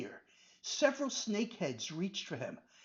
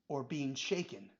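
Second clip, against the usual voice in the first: usual speaking voice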